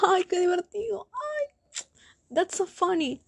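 high-pitched mock delight; fake amusement. Mocking the classmate's icebreaker, fake amusement. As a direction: pretend it is hilarious, sarcastically.

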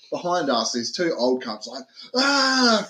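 normal talking voice

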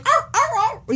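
high barking arr sounds; seal bark. Retold or imitated. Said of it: "when seals clap and they're like".

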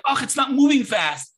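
high, loud, dragged-out whine of impatience; impatient complaint. Retold or imitated. Retelling a comic's cell phone user, impatient. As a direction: mimic an exasperated phone user.

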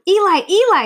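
high, loud cry of alarm; frantic screech. A narrator's reading. Vulture rousing her lion friend, frantic screech. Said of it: "screeched the frantic bird".

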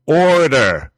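booming godlike command; commanding call. Acted. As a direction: call the world to order. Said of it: "he called".

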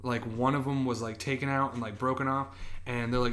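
normal talking voice

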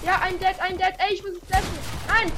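loud, high, hyped callouts; excited shouting. Real, not acted. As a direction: yell it mid-fight. Fight in game, calling out.

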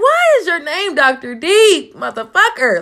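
high, slow, crying with laughter; cracking up at the name; laughing disbelief. Retold or imitated. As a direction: laugh through the question. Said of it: "I was like".

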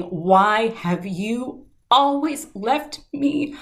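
retold crying scream. Alone on the trail, pleading to God, retold anguished cry. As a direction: replay the desperate cry at the sky, slow and strained. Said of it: "I was crying and I was screaming like a three-year-old".

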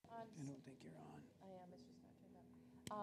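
breathy whispered aside. Real, not acted. Pastor whispering to a helper that her mic is off. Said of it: "whispered aside about mic".

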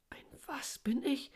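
taken aback, voice lifting; surprised. A narrator's reading. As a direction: ask in baffled surprise. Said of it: "fragt Wimpel überrascht".

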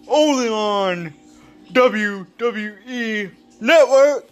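booming wrestling announcer promo; big ring announcer hype voice; dramatic reality show hype. Acted. Wrestling event promo intro.

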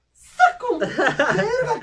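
grito mucho más agudo y fuerte; grito de dolor fingido. Retold or imitated. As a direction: recrea el alarido del jeringazo. Retold reaction to the injection. (grita como niño al recibir la inyección)

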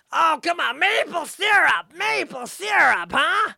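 hammed-up high yell, dragged out; angry squawk. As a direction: bellow it like an outraged comic. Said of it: "He's always so angry".

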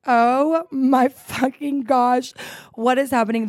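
loud, drawn-out retold exasperation; hammed-up stunned outburst; mock disbelief. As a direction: stretch it out in appalled astonishment. Reacting to being screamed at.

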